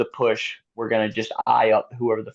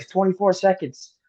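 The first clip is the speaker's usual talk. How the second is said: higher mock shout of the split; retold call-out; 8 semitones higher than usual. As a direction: call out the gap like a trackside crew member. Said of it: "they started yelling out the lead".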